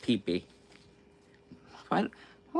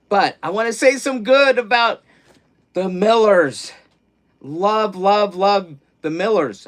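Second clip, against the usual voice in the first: excited; 8 semitones higher, 8 dB louder than usual. Real, not acted. Gushing about the Millers.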